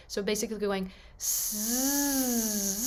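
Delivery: slow steady voiced zzz like a bee; buzzing zzz. Shown as a demonstration. Coach showing the Z buzz to students, a steady bee buzz. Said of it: "a voiced S".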